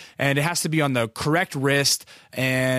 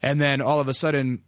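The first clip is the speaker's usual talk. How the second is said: normal talking voice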